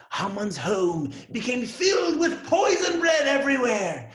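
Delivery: plain reading voice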